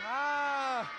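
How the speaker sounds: loud drawn-out ah; shouted ah. Acted. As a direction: cheer a long ah. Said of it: "Everybody go".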